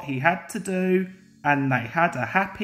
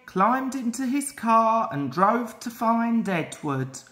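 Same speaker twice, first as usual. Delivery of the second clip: plain reading voice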